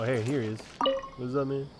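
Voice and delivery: normal talking voice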